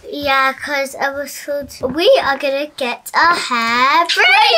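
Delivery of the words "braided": high, loud excited squeal. Real, not acted. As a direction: burst out with excitement on the word. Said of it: "That's why you're excited".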